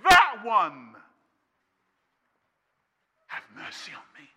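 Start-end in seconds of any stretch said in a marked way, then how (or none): 3.3-4.3 barely breathed whisper of the plea. Acted. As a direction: whisper it, almost to yourself. Plea repeated after the shouting.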